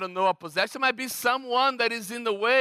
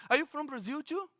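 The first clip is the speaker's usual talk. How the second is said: higher, softer questioning voice; curious wife; 7 semitones higher, 6 dB quieter, faster than usual. Retold or imitated. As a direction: imitate a curious woman asking, pitched up.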